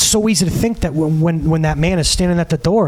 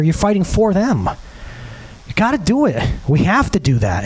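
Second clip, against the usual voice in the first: ordinary talk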